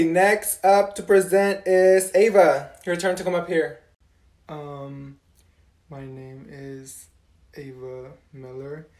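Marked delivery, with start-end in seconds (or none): 4.5-8.9 barely audible shy whisper. Acted. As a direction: whisper it, too shy to speak up. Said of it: "why is she whispering?".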